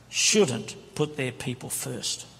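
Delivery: drawn out slowly, quoting the premier; slow quoted question. Retold or imitated. Preacher quoting his premier, slow and deliberate. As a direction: let each word land slowly. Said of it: "He said".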